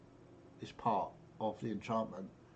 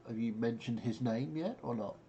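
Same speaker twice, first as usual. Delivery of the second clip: ordinary talk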